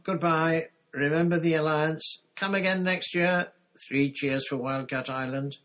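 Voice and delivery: high, loud calls, easing slightly at the end; shouted farewells. A narrator's reading. Parting crews call to each other across water, shouted. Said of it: "There were more shouts of".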